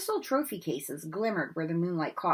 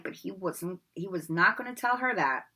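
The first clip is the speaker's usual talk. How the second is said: usual speaking voice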